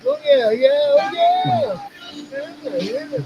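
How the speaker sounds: very high falsetto squeal of yeahs; mock squeal. Teasing the troll, in a very high falsetto squeal.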